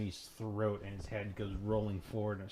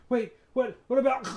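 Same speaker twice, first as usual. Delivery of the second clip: high, loud bewildered question; confused thug; 14 semitones higher, 10 dB louder than usual. Acted. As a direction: sputter in confusion. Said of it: "is like".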